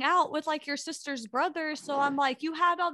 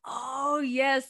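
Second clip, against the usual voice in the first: normal talking voice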